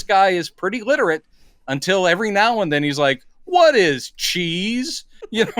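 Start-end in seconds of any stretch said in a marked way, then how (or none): 3.5-5.0 slow, puzzled monster question. Retold or imitated. Creature's naive question, mocked.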